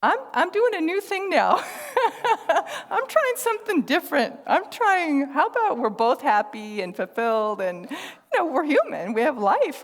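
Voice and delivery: high, loud laughing reply; telling a friend about James, laughing; laughing brightly. Retold or imitated. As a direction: giggle through a happy answer. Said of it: "And I said".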